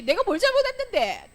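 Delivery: high, loud defiant comeback; talking back. Acted. Teacher shows a punished child talking back, acted defiance. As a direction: act a child answering back indignantly. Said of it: "대들지".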